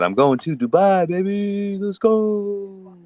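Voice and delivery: hyped-up imitation of a friend; excited hype. Imitating the Dubai winner's excited cheer. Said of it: "He said".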